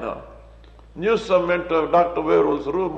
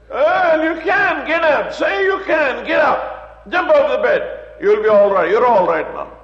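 loud, high forceful command; preacher urging the sick man to rise; commanding shout; 7 semitones higher, 5 dB louder than usual. Retold or imitated. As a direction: order him out of bed, louder. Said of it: "commanding the sick man".

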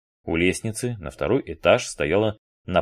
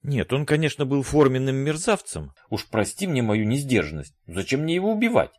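higher-pitched indignation; protest, outraged and higher; indignant; 7 semitones higher than usual. A narrator's reading. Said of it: "зазвучало возмущение".

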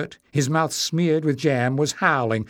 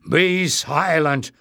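slow, angry command; stern order, pitch raised; growling; 4 semitones higher, 4 dB louder, much slower than usual. A narrator's reading. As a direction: growl it, drawing out each word. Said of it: "growled Barda".

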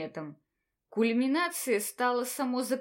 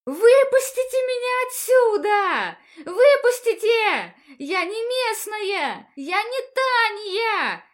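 screaming; 10 semitones higher, 8 dB louder, slower than usual. Acted. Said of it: "заорала во всю мощь своих лёгких".

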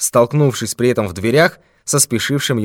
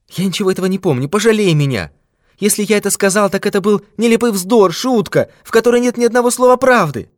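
much higher, quick frightened pleading; pleading old man; 10 semitones higher, faster than usual. Acted. Old miser begs the thief for mercy, pleading. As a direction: beg him to believe it was a joke.

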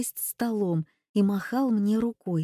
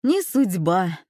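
rueful sigh over a lost lunch, louder; rueful lament; 5 dB louder, slower than usual. A narrator's reading. Man resigning himself to no sausage at the long queue, rueful. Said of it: "посетовал".

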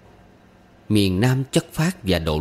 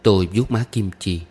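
plain reading voice

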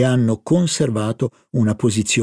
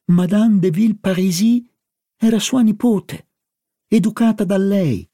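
growing astonishment; 6 semitones higher than usual. A narrator's reading. Narratore stupito dalla scoperta.

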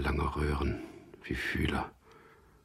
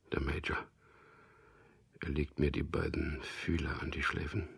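ordinary talk, nothing marked

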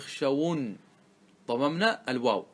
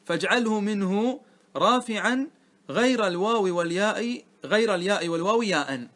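verse recitation; 3 semitones higher than usual. Shown as a demonstration. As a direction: lift and stretch the poem's line. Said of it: "ثم قال".

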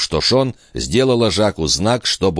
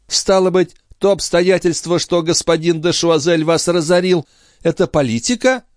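high-pitched lady's retort; countess's pointed question; 12 semitones higher than usual. A narrator's reading. Countess voiced in king's dialogue.

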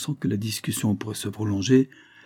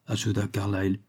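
ordinary delivery

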